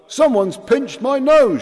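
high, loud complaint; shouting. Acted. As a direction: yell it indignantly. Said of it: "shouted Ireton".

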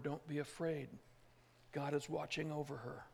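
hushed, soft comfort; whisper-soft promise of safety; God's reassurance. Acted. Inner word from God to the listener.

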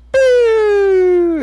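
loud high mock explosion; firework boom. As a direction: belt out a firework bang. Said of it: "and it would go".

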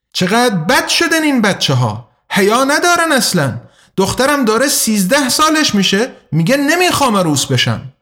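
high-pitched, mocking impression of a complaining woman; mimicking a scandalized woman. A narrator's reading. Gossip imitating a pious committee head lamenting today's kids, high mimicking voice. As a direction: put on her indignant voice, swooping up high. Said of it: "ادای خودش را درآورد".

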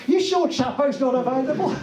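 very high, hammed-up caller's voice; doubtful phone caller. Caller hoping to avoid the substitute, high comic doubtful voice. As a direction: plead in a high put-on voice for the other man.

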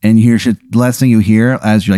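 ordinary talk, nothing marked

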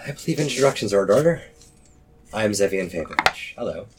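calm, polite, unbothered; perfectly calm voice. Acted. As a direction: introduce yourself as if at dinner. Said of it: "In just a perfectly calm voice".

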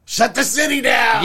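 loud, high, drawn-out shout; hammed-up bellow to a whole town; mock shouted order. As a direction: call it out slowly as a joke command. Jokingly acts out wife's demand.

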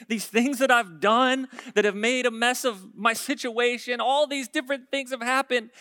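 loud, high cry to God; pleading. Acted. Preacher voicing David crying out to God, pleading. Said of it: "pleading with him".

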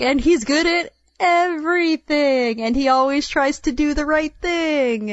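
mock exasperation. Reader gripes about a too-perfect hero, mock exasperation. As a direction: stretch it out as if fed up with perfection.

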